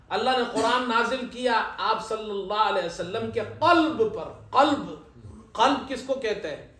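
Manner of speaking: usual speaking voice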